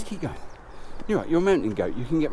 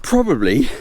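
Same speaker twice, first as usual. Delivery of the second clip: ordinary delivery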